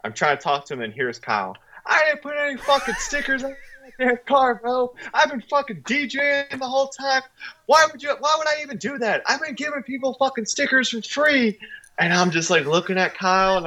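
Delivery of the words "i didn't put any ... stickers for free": squeaky, much higher indignant protest; indignant high-pitched rant. Retold or imitated. Kyle protesting to the angry car owner, high and indignant. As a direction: play Kyle as a flustered, outraged kid. Kyle angrily denies accusation.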